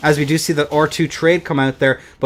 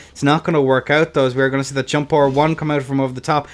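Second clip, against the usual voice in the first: ordinary delivery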